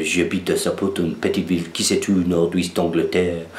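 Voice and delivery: mock-proper French accent, playing the native. Shown as a demonstration. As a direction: put on a French accent for "J'habite à Southport". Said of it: "act like you're French".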